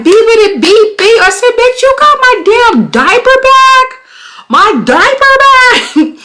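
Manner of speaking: high-pitched furious yell at the thief; ratchet style yelling. Retold or imitated. Woman confronting diaper bag thief in the street, high furious yelling. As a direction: screech it out, outraged and stretched. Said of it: "went ratchet style".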